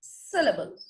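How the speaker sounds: Syllable slowly, weight on 'सि', falling after it; stressed first part. Shown as a demonstration. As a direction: stress 'सि' and let the rest of syllable drop. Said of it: "अगर मैं stress part को". (Syllable में 'सि' पर ज़ोर)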